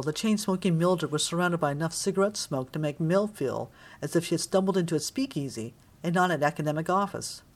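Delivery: ordinary delivery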